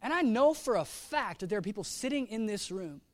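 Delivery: usual speaking voice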